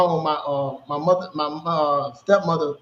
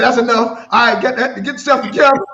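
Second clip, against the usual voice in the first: loud, higher imitation of dad, breaking into laughter; dad laughing; 5 semitones higher, 8 dB louder than usual. Son retelling dad's comfort, laughing as he imitates him loudly. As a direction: play dad's brisk order through your own laughter. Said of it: "this the part made me laugh".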